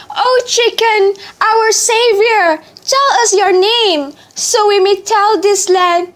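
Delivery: high, awed praise; grateful fishermen. Acted. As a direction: hail the hero with awe. Fishermen praise their savior.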